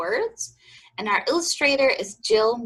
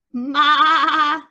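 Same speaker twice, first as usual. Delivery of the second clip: high, loud wavering bleat; quavering held maa; goat bleat; 7 semitones higher, 6 dB louder than usual. A narrator's reading. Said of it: "said a goat".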